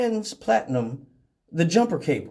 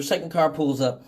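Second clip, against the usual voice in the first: ordinary talk, nothing marked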